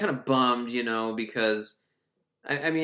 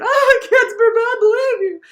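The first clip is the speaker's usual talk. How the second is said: mock shout; 12 dB louder than usual. Villain shouting at the kid, shrieked cartoon scream. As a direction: shriek it like a soap opera villain.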